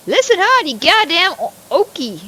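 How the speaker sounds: put-on furious old farmer, loud and high; angry shout. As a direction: bark it at the neighbor.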